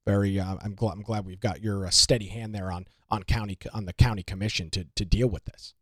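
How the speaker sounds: low, soft, deadpan praise; damn with faint praise, quietly and low; dry sarcasm. Real, not acted. Host mock-thanking the commissioner for a steady hand, dryly sarcastic.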